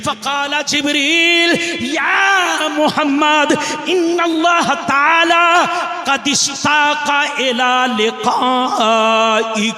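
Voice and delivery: high, slow, ringing chant-like climax; impassioned preaching. Acted. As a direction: draw out the vowels and build to a climax. Climax of sermon recitation. (जोश में खींचकर ऊँची तिलावत)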